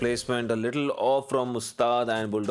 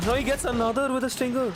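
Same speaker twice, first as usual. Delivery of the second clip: excited; faster than usual. Real, not acted. Caster hyped over a multi-kill.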